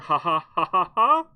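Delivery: forced, loud ha-ha laugh; mock laugh. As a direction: put on a fake laugh. Jokes that he didn't laugh.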